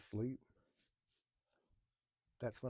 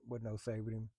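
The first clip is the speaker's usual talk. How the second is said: ordinary delivery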